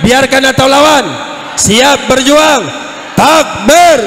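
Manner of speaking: loud, sustained crowd-rousing shout; full-force rallying preacher. Real, not acted. Preacher rallying congregation to defend their scholars, full-force shout. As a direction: drive each pledge home at full volume.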